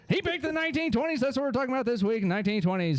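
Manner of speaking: put-on fast, high 1920s radio mid-Atlantic accent; old-timey mid-Atlantic radio announcer. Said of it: "mid-Atlantic accent going on there".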